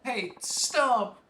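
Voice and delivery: a young boy yelling, high and bright-voiced; high boy's shout. Streamer voicing a boy chasing a pelican, high kid shout. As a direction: shout it as a little kid in a game.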